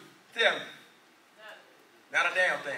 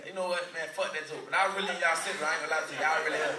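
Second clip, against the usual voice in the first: usual speaking voice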